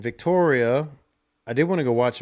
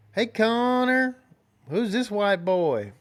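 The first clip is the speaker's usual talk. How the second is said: high, slow, teasing mock voice of the local women; mock women's voice; 10 semitones higher, 3 dB louder, slower than usual. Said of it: "They probably don't talk like that".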